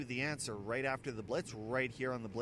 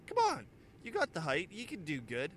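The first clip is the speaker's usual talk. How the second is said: coaxing the stranger; 5 semitones higher than usual. Retold or imitated. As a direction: pitch jumps way up to coax him. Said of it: "He goes".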